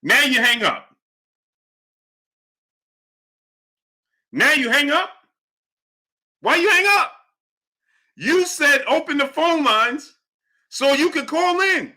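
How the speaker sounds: high-pitched incredulous needling; mock outrage. Real, not acted. Host taunting the caller who hung up, high mock outrage.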